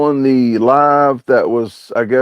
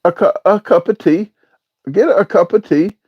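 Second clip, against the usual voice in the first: high-pitched mock British; amateur British accent in a higher voice; fake British accent; 8 semitones higher than usual. Shown as a demonstration. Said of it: "preview of your fake British accent".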